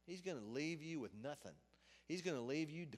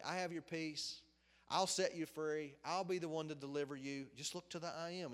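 rising call and answer, louder on each I am; preaching at full force; 3 semitones higher, 4 dB louder than usual. Acted. Preacher calling out to the congregation, preaching at full force. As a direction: proclaim it to the congregation, building.

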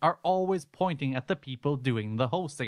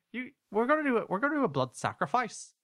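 high mock Northern Irish accent; hammed-up Northern Irish, swooping upward; Barry; 9 semitones higher than usual. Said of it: "That's our great Northern Ireland accent".

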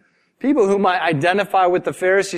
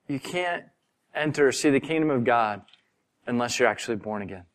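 hushed; 5 semitones lower, 7 dB quieter, faster than usual. Retold or imitated. Jesus's reply retold quietly.

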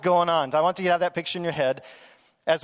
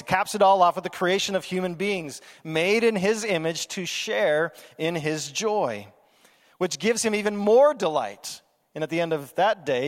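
ordinary talk

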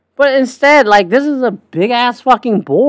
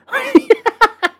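high laugh. Real, not acted. Laughs at his own joke.

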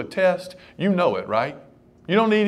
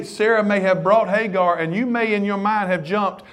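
ordinary delivery

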